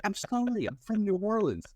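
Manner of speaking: high, sheepish apology; high apologetic voice. Retold or imitated. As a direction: squeak out an embarrassed excuse. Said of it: "I'm like".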